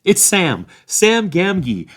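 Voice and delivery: loud, high answer; calling back. Acted. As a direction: shout your name across the lane. Sam calling back loudly.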